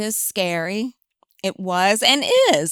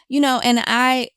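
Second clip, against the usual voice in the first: ordinary talk, nothing marked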